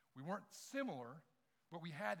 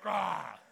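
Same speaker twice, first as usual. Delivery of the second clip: mock yelp; 16 dB louder than usual. Retelling friend's cry, laughing.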